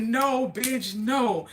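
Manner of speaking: retold firm telling-off to a friend; emphatic refusal. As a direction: put your foot down with a drawn-out no. Retells scolding her drunk friend.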